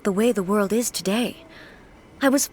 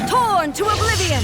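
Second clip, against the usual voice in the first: fierce, high shout as the blow lands; battle cry; 10 semitones higher than usual. Acted. Unleashing a finishing strike on monsters, fierce high shout.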